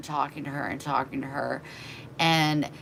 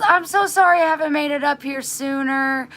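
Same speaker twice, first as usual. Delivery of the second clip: higher, mock apologetic gush; fake-sweet neighbor; 9 semitones higher, 6 dB louder than usual. Neighbor apologizing to the daughter, sugary and high. Neighbor woman voiced in story.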